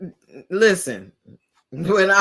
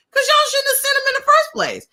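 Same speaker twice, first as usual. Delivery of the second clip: much higher, strained indignant retort; retold outburst. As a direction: parent snaps back at the school about early release. Parent retorts to school.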